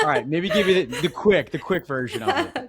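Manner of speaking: talking through real laughter, pitch jumping high; amused host laughing mid-sentence. Real, not acted. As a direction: let the laugh break into the words. Host laughing at guest's joke.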